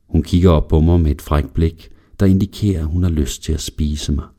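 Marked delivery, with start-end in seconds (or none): none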